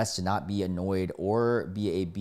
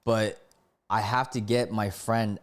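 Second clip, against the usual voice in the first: ordinary delivery, nothing marked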